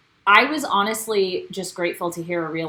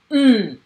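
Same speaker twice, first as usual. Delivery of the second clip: dismissive mm; 3 semitones higher, 6 dB louder than usual. Retold or imitated. Dismissive grunt in retold scene.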